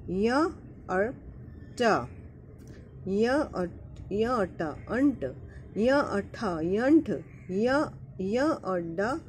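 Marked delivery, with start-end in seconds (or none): none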